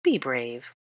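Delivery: lightly voiced teasing comfort; mock encouragement. A narrator's reading. Draco teasing Granger before the Floo, mock encouragement. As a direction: pat her on the head with words, half mocking. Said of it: "said Draco in mock encouragement".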